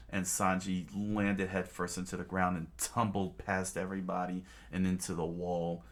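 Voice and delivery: ordinary delivery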